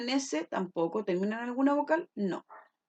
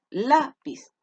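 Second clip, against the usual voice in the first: LÁ in Lápiz punched higher and louder; stress on first syllable; 4 semitones higher, 6 dB louder than usual. Shown as a demonstration. Teacher showing where the voice falls, LÁ stressed in Lápiz. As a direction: lean hard on the LÁ of Lápiz. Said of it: "Se carga la voz acá, en la".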